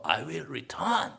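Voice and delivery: drawn-out, very slow English; slow dramatic line. Shown as a demonstration. Preacher giving an English rendering, very slow. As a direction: stretch every word of I will return. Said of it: "English rendering, very slowly".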